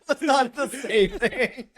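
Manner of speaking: high, loud, slow through laughter; laughing protest. Real, not acted. Co-host protesting the diaper joke, laughing hard. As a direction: object while cracking up. Diaper pun cracks them up.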